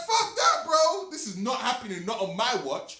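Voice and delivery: high-pitched mock outrage; indignant refusal. Retelling his refusal to the French girl, indignant and high. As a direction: protest it hotly, pitch jumping up.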